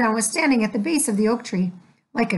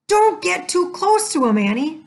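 shout; 7 semitones higher than usual. Acted. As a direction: warn his sister off. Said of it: "Jack shouted".

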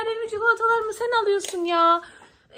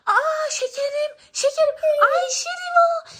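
high, loud wailing outcry; outraged cry; 7 semitones higher, 4 dB louder than usual. Acted. Girl spots her candy with Ayşe Reva, outraged wail. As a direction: cry out in dramatic dismay, stretching the name.

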